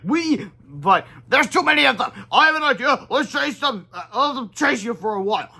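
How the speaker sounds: high, loud urgent hero voices; hero dialogue. Acted. Heroes outnumbered by robots, urgent high voices. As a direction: plan fast mid-battle.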